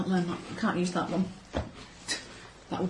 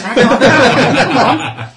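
laughing protest; 5 semitones higher, 14 dB louder than usual. Real, not acted.

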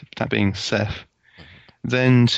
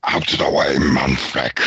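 creature voice; 10 semitones lower, slower than usual. Acted. Raging creature charges, bellowing.

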